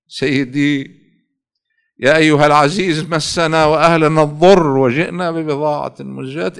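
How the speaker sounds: loud, fervent plea to the Imam; impassioned preaching. Acted. Preacher pleading with Imam Ali, impassioned preaching. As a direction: cry out your supplication with full force.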